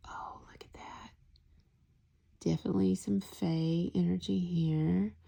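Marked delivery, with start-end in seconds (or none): 0.1-1.1 whisper. Real, not acted. Whispered tarot card reveal.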